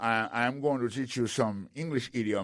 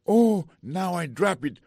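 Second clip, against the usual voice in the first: a sudden high, loud "oh" of dismay; startled as the phone slips; dismayed surprise; 7 semitones higher, 7 dB louder than usual. Acted. Student fumbles her phone, dismayed surprise.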